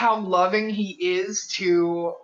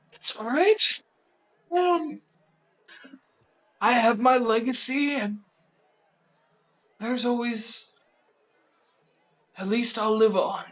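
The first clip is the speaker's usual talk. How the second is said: put-on raised, airy old man reassuring his creation; old wizard voice; 4 semitones higher than usual. Game master voicing the old wizard to his automaton, high and gentle.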